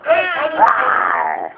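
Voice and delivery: low playful growling roar; tiger roar. Pretend. What's the tiger say.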